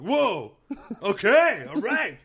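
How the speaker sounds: high, loud laughing "whoa"; taken aback, impressed; impressed surprise. Retold or imitated. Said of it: "And I was like".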